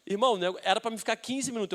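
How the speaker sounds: ordinary delivery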